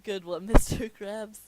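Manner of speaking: real laughter breaking into the words; laughing. Real, not acted. Reader cracks up at the joke.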